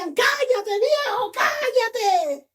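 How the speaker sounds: high, scolding crowd voice; crowd hushing him. Acted. Crowd telling the blind beggar to shut up, scolding. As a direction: order him silent in a high, strained voice. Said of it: "Y la gente le decía".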